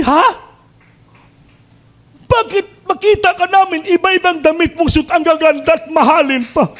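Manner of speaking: high, astonished disbelief; surprised church members marvelling at her clothes; amazed question. Acted. Brethren amazed by her dresses.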